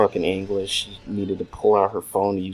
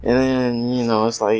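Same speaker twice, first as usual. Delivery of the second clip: ordinary talk, nothing marked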